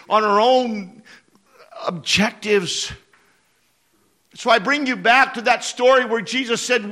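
high, loud sermon climax; impassioned preaching. Real, not acted. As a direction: preach the altar call at full force. Preaches closing altar call.

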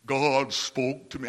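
low, slow mock voice of God; lightly hammed divine voice; mock booming God voice. Shown as a demonstration. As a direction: intone it like a spooky oracle. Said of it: "notice how I'm saying this".

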